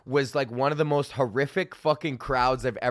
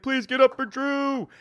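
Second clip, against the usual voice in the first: mock announcer; 10 semitones higher than usual. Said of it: "It's like".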